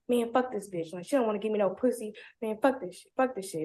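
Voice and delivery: muttered imitated anger; mild mock tantrum; mock frustrated rant. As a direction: grumble it like he flipped. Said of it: "goes bonkers".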